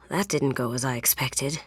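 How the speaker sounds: soft whispered aside after a setback. Real, not acted. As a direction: breathe it out under your breath. Plan fails, aside to partner.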